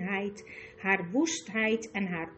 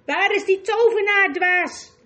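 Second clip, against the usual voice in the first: higher, louder furious demand; furious queen; 9 semitones higher, 10 dB louder than usual. A narrator's reading. Witch queen demanding the magician again, furious. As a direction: bellow it at the fool. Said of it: "zei Jadis".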